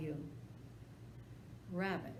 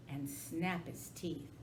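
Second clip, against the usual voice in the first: plain reading voice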